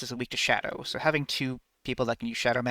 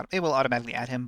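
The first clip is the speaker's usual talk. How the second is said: ordinary delivery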